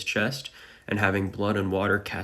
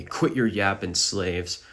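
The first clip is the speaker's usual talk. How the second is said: usual speaking voice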